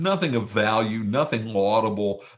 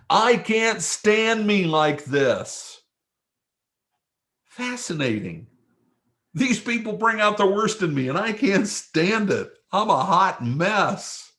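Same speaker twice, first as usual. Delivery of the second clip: exasperated Moses; 8 semitones higher, slower than usual. Retold or imitated. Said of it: "That I may not see".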